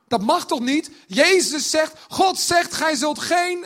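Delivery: loud and high, scandalised; indignant Pharisee. Retold or imitated. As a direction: protest like an outraged Pharisee. Pharisees object to Jesus. (verontwaardigde farizeeër, luid en hoog)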